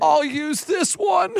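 high, slow, brave little declaration; dramatic kid. Retold or imitated. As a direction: play the tiny actor recovering. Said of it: "exact dramatic pause".

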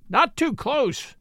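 high, slow caution; warning. A narrator's reading. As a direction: warn them off, stretching the words. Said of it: "warned Jones".

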